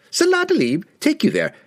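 very high, frantic merchant voice; frightened merchant. A narrator's reading.

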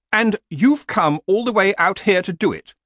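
high horse voice, arguing; objecting horse. Acted. Horse questions killing a troll, high and argumentative. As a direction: challenge him, pitched well up.

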